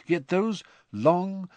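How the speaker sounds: plain reading voice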